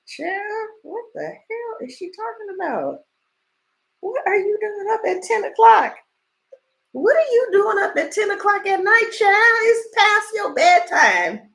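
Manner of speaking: high, loud, scolding mother's voice. Retold or imitated. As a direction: scold the child for being up too late. Mother scolding late at night.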